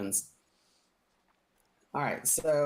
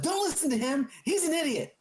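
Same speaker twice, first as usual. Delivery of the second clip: much higher, squeaky protest; indignant captive; 13 semitones higher than usual. Acted. Captive contradicts his partner, high squeaky protest. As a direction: snap it at your partner.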